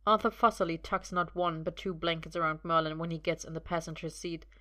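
ordinary delivery

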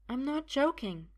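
high-pitched woman's voice; high, serious denial; insistent woman. Acted. Sophia insists.

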